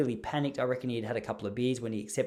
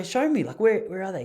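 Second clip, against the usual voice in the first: eager request; 6 semitones higher, 5 dB louder than usual. Retold or imitated. Teacher eagerly asks to see.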